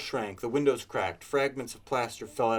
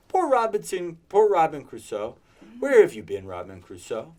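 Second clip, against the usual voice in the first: loud, high sing-song calling; mimicking parrot; 6 semitones higher, 8 dB louder than usual. A narrator's reading. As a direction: call out like the fond parrot. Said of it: "cried Scrooge".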